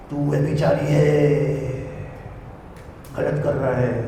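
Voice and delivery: low, soft, drawn-out imitated accusation; hushed rebuke. Lord's servant accusing the speaker, imitated in a low, soft drone. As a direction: murmur it low, stretching the last word.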